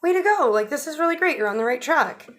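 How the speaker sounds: hammed-up proud reviewer; impressed praise. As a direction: cheer the sun on with surprise. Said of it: "Pluto is like".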